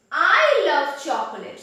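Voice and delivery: "I" stretched and loud, the rest falling away; emphatic "I" at the start; stress on I. Shown as a demonstration. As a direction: lean hard on "I". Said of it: "on which I lay the emphasis".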